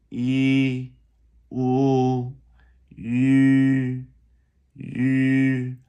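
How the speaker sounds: "yi, wu, yu, yu" each a sustained high level tone; vowel demonstration. Shown as a demonstration. Teacher reminding learners of vowel sounds, held vowel demonstration. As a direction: hold "yi", "wu", "yu" separately, high and steady.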